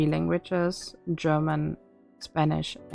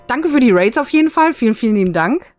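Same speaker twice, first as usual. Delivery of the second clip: fast, loud excited thanks; 5 semitones higher, 9 dB louder, faster than usual. Real, not acted. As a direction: gush out the thanks.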